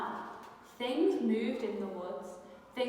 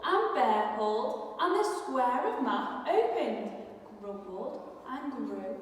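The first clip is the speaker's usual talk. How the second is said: ordinary delivery, nothing marked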